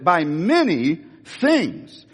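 slow, drawn "By many things"; slow stressed quote. Shown as a demonstration. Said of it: "What he said".

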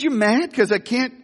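high playful "you mad?"; high, light teasing question. Retold or imitated. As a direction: needle the kid gently. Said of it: "I said".

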